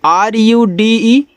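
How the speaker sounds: ordinary talk, nothing marked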